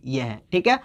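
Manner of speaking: usual speaking voice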